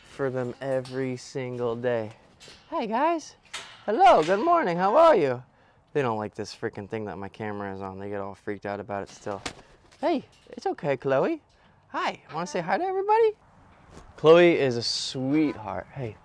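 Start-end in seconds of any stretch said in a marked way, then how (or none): 2.7-5.4 high, bright sing-song greeting to pets; cooing at birds. Real, not acted. To his birds in the cage, high cooing pet talk. 10.0-13.3 soothing pet talk. Real, not acted. Calming Chloe, scared of the camera, high cooing reassurance.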